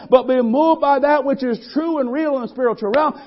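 loud, high, driving sermon climax; preacher whipping up the congregation; full-force preaching. Real, not acted. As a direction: preach it with rising fervour.

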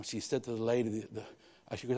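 ordinary delivery

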